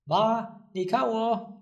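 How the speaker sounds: lightly voiced excited child; child calling mom. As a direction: call out Ma, 你看我 like an eager kid showing off. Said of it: "they would shout to their, uh, mothers".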